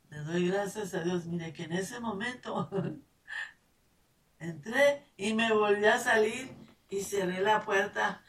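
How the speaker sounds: ordinary delivery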